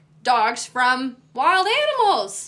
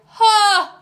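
eager fight-hungry dog; 3 semitones higher, 8 dB louder than usual. Acted. Leashed dog spots another dog, loud eager challenge.

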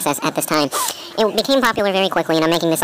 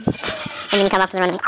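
ordinary talk, nothing marked

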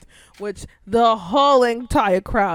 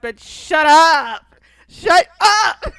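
higher, louder shrieking shut up; dragged-out then screamed shut up, half laughing; mock yell; 6 semitones higher, 9 dB louder, much slower than usual. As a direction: yell at the TV in fake outrage. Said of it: "I'm just like".

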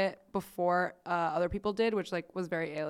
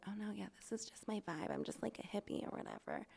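breezy soft quote; 15 dB quieter, faster than usual. Retold or imitated. Pretty friend excusing her vibe.

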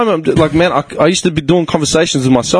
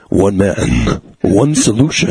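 movie trailer voice; imitated film-trailer announcer; Don LaFontaine; 4 semitones lower than usual. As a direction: intone it like a trailer narrator, with dramatic pauses. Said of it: "original movie voice guy".

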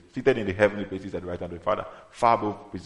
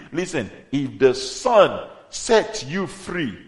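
loud, impassioned sermon delivery, rising higher; full-force preaching; 4 semitones higher, 6 dB louder than usual. Acted. Preacher tells believers they are set free, full-force preaching. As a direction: drive the message home to the congregation.

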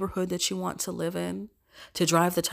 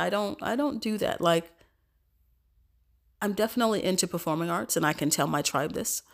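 ordinary delivery

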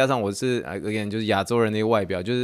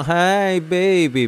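mock Hanamichi flirt; 7 semitones higher, 3 dB louder, much slower than usual. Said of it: "我觉得他外形有点像花轮".

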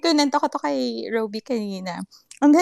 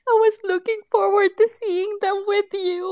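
a touch of retold crying, raised and wavering; half-sobbing the words again, lightly; tearful confession; 8 semitones higher than usual. Telling Mama why she cried, mildly tearful. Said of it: "yung pag-iyak ko kay Mama".

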